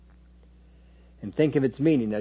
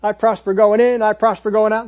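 loud, high rote declaration; mock confession chant, higher and louder; rattled-off confession; 7 semitones higher, 8 dB louder than usual. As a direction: belt it out like an automatic slogan. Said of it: "just rattle them off".

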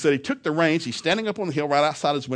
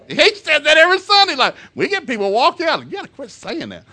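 high, loud indignant protest; mock indignant outburst; 13 semitones higher, 9 dB louder than usual. Teller mimics churchgoers upset at the pastor, mock indignant outburst. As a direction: complain at full pitch, loudly. Imitating congregants' complaint about pastor's joke.

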